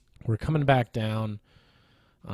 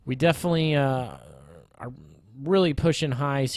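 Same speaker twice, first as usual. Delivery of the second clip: higher and louder restart; voice getting higher; 3 semitones higher, 4 dB louder than usual. Real, not acted. Trader resuming his point about pushing highs, higher voice. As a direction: pick it back up with a raised pitch. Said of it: "as my voice gets higher".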